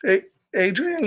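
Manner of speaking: ordinary delivery, nothing marked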